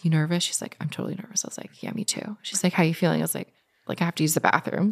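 imitating a timid, under-the-breath exchange; hushed nervous exchange. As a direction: murmur it like two nervous seatmates.

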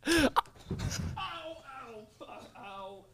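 pained, hushed ow after hitting hand; real pain yelps. Real, not acted. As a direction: wince through the ouches. Hurts hand on couch wood.

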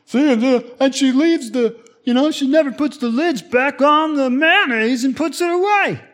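high-pitched, hammed-up complainer; upset griping. Comparing notes on a spouse's habits, upset griping. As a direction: vent indignantly in a put-on high voice. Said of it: "things that you were upset about".

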